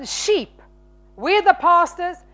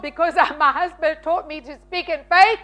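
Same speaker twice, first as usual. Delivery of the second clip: ordinary talk, nothing marked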